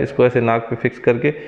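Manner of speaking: muffled behind surgical mask; speaking while fitting mask; through a face mask. Real, not acted. Seen 0: Surgical mask pressed over nose and mouth throughout labelled words.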